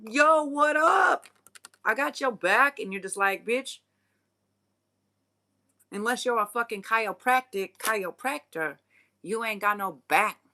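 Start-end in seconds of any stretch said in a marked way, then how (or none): 0.1-2.8 much higher, louder, slow hype-man greeting; mock fake buddy. Freeloaders arriving at mealtime, hammed-up loud buddy voice. 3.4-10.4 sassy put-down. Retold or imitated. Cutting off the freeloaders.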